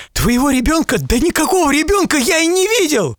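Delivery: high, bewildered protest; protesting merchant. A narrator's reading. Merchant denying he saw any child, high frightened protest. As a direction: deny it in alarm.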